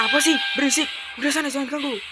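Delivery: loud, high irritated outburst; angry husband. Acted. Husband brushing off his wife, angry. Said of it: "Kok kamu malah marah?".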